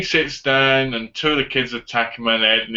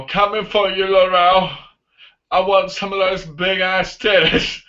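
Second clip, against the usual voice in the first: higher, leering bad-guy call; put-on stalker voice; mock villain; 6 semitones higher than usual. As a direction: imitate a creep calling to his prey. Imitates the villain calling out.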